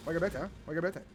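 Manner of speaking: ordinary talk, nothing marked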